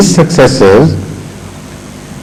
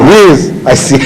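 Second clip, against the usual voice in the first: ordinary delivery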